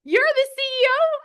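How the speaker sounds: very high, loud incredulous question; screaming disbelief. Retold or imitated. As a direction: reenact a stunned fan's squeal. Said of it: "I was screaming".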